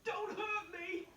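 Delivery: a big lion pleading with a mouse; whimpering lion. Acted. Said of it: "he whimpered".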